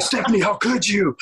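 high-pitched, playfully wounded protest; mock argument. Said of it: "we were arguing for hours".

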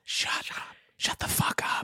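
forceful whispered order. Shown as a demonstration. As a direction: whisper it, insisting. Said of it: "number one thing to whisper".

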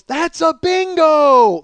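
roaring that's a bingo; crowd yell. Retold or imitated. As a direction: yell it out like a whole bar. Whole bar yells catchphrase.

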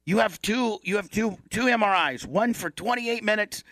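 lightly voiced staffer explaining the procedure; MRI technician. Acts out MRI technician giving instructions.

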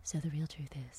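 hushed mock off-mic secret; confidential whisper. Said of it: "just let me turn this off".

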